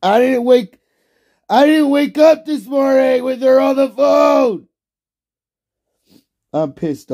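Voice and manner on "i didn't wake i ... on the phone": loud, much higher ranting; angry rant. Real, not acted. Streamer ranting to chat about girlfriend, angry. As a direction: vent it all out, loud.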